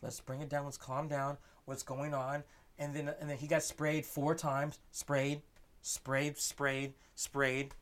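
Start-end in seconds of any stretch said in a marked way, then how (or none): 0.0-2.4 quiet, measured de-escalation; calm. Retold or imitated. Driver trying to calmly communicate with police, calm and soft. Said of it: "try and calmly communicate".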